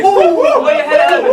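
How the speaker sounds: giggly falsetto brag; high laughing line. Acted.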